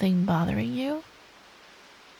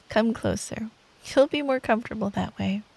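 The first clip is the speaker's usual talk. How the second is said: usual speaking voice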